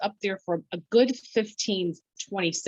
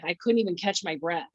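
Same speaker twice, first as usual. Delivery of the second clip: ordinary delivery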